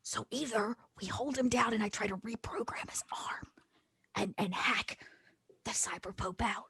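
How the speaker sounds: hushed, halting plotting; conspiratorial whisper. Pretend. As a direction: whisper the plan to the huddle. Plotting in the secret huddle.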